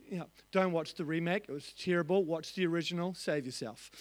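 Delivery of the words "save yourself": much lower, much softer murmur; a dry, low joking aside; mock warning. Real, not acted. Joking warning about the remake.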